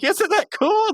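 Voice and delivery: high-pitched cartoon eagerness; mock eager enthusiasm. Podcaster voices Pops showing off the doll, high eager question. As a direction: pitch it way up, seeking approval.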